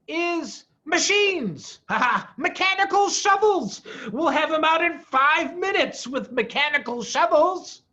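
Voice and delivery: high, loud, with a laugh; gleeful scheming. A narrator's reading. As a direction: relish the idea like a villain. Farmer gloating over his plan.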